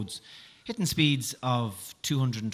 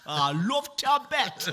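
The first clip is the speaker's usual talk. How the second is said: high, slow hammed-up accent with a laugh; mock Indian accent; 10 semitones higher, slower than usual. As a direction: giggle through a silly put-on accent. Joking accent banter.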